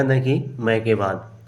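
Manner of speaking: ordinary delivery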